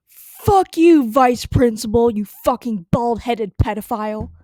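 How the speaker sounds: retold drunken rage; furious yell. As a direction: scream it at him. Insulting vice principal.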